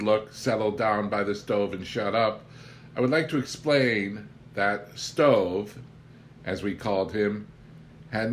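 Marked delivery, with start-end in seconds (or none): none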